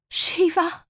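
high desperate call; desperate cry. Acted. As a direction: cry the name out. Cries out while choking.

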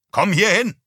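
loud, high summons; shouted command. Acted. Father orders his wife to the window, loud and high. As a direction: bark for her to come over.

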